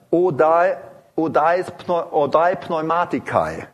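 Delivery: Odai Pneumatikai spelled out slowly in Greek; careful Greek Odai Pneumatikai; Greek term. Shown as a demonstration. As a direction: sound out Odai Pneumatikai syllable by syllable. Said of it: "Paulus spricht also hier von".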